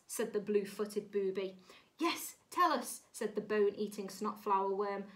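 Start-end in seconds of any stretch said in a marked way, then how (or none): 2.0-3.0 high, insistent story voice; acted excited plea; eager urging. A narrator's reading. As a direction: chime in eagerly, pitched up. Animals eagerly demand the name.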